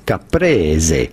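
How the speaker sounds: caprese as ka-PRE-ze, low and clear; the Italian way to say caprese; Italian pronunciation. Shown as a demonstration. Pronunciation clip giving caprese in Italian. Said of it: "In Italian".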